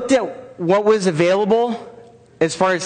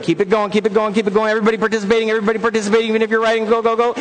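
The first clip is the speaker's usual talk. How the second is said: rapid-fire cheerleading on a raised, steady pitch; urging the crowd on; 3 semitones higher, much faster than usual. Real, not acted. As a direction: keep them going, building as you go. Urging audience through a drum roll.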